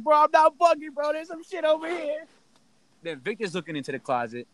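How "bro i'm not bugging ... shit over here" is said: very high, squealed frantic retelling; mock panic. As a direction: squeal it like you're freaking out. Acts out panic on seeing shadow.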